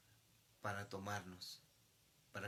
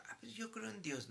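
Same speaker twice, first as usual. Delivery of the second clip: mock believer; 10 semitones higher than usual. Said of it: "muchos dicen".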